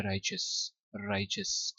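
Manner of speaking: "राइचस" in two syllables, RAI-chus; correct pronunciation. Shown as a demonstration. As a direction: model "राइचस" with "ch" and no "ee" sound. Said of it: "इसको पढ़ना है". ("राइचस" को "च" से, दो हिस्सों में)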